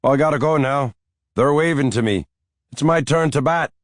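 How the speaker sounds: put-on high, loud eager old man; excited old Irish grandfather. A narrator's reading. Grandfather rushes off the phone to play ball, excited. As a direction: hurry off to bat like a giddy granddad.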